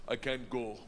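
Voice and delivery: put-on low-pitched Russian accent; imitated Russian accent. Russian gangster turning down his friend, Russian accent.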